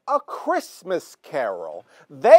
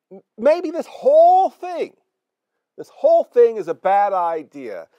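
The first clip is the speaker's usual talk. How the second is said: agitated outburst; 6 semitones higher, 6 dB louder than usual. Acted. Host upset, voice rising.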